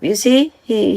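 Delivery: ordinary delivery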